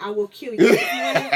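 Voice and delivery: loud mock threat, half laughing. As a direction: threaten a friend loudly, in jest. Retells threatening friend over Facebook photo.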